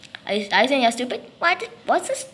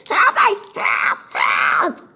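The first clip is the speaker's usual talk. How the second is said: desperate cries for help; slower than usual. Acted. Victim screaming to friends while being taken. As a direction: scream for help as you're dragged.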